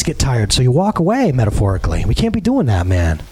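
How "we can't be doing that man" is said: ordinary delivery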